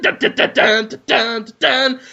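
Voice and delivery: hummed suspense sting; mock dramatic dun dun dun. Teller adds a mock suspense sting to his story. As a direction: sing it like a horror chord.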